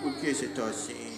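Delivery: soft, low, tender coaxing. Real, not acted. Soothing a crying baby. (consolando o bebê baixinho)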